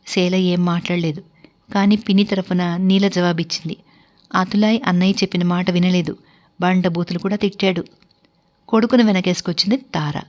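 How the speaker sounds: ordinary delivery, nothing marked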